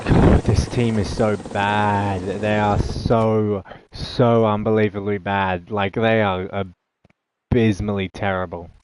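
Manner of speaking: loud, slow, drawn-out exasperation; exasperated rant. Real, not acted. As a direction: stretch it out, utterly fed up with the team. Fed up with his losing team.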